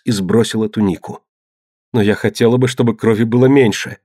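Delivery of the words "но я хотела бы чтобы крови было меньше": ordinary delivery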